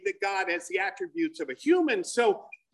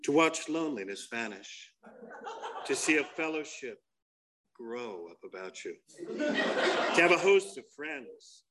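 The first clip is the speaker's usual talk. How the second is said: commercial voice-over; 5 semitones lower than usual. Acted. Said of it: "you hear this voice coming".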